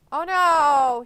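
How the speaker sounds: loud startled oh no; sudden loud alarm at the fall; alarmed cry. Real, not acted. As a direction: shout it out as he falls. Watching gymnast fall on stream.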